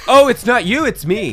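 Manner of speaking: high, loud mock breakup line; mock apology. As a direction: play the dumped apology big.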